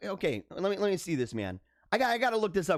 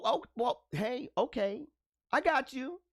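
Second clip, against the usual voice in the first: ordinary delivery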